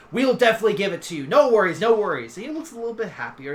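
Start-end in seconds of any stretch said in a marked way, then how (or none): 0.1-2.3 quick, louder, breezy dragon head. Acted. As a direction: toss out reassurance in a rush.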